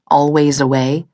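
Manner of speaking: plain reading voice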